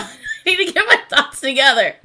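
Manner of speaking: ordinary talk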